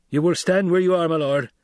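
loud hard command; stern threat. Acted. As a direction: order him to stay put. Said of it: "Gurney said".

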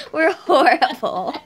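high laughing burst. Real, not acted. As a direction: laugh through the words. Laughing at failure.

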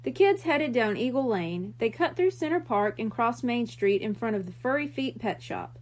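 usual speaking voice

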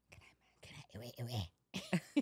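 hushed, barely-there whisper; acting out a timid whispered prayer. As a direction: whisper it haltingly to God. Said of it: "Why you gotta whisper?".

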